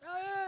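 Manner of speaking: high mock crying wail; high kid starting to bawl; child's wail. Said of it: "he started to cry".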